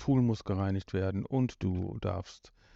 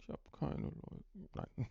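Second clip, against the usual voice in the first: mock whisper; 6 semitones higher, 15 dB quieter than usual. Joking self-pity, whispered.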